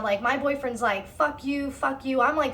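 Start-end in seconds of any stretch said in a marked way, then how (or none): none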